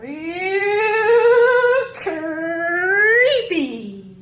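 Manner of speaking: high, squealing disgust. Acted. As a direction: recoil with a shudder. Reacts to channeled author.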